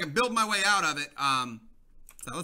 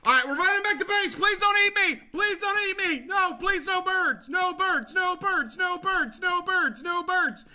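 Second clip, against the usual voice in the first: high, frantic repeated begging; panicked pleading; 9 semitones higher than usual. Real, not acted. Player begging birds not to eat him, high panicked pleading. Fleeing birds back to base.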